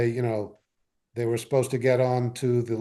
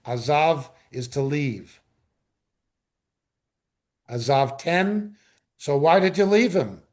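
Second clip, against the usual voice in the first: normal talking voice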